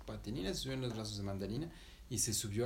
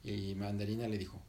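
ordinary delivery, nothing marked

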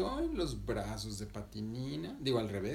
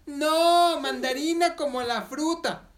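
much higher, louder insistent correction; 11 dB louder, slower than usual. Acted. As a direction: Mandarina corrects the vendor, high and loud. Girl corrects man's mishearing.

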